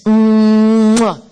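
loud, smacking mwah; exaggerated smooch; big kiss noise. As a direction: plant a huge, drawn-out kiss. Acts out kissing her mom.

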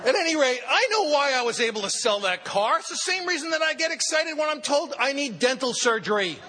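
excited comic wrap-up; gleeful punchline. Real, not acted. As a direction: land the joke with glee. Punchline delivered laughing high.

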